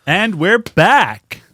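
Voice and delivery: slow, booming radio host; radio announcer. Pretend. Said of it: "Say it like a fucking radio".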